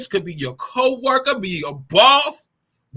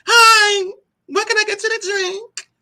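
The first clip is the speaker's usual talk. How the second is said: high, sweet, put-on politeness; high sugary service voice; 10 semitones higher than usual. Taking a drink order, high sugary hostess voice. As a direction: overdo the customer-service sweetness. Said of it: "No, I don't sound like that".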